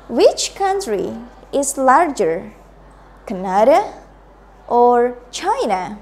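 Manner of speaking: slow repeat-after-me, "Canada" rising, "China" falling; choice-question intonation. Shown as a demonstration. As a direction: lift "Canada", draw out "or", let "China" fall. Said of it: "Please repeat".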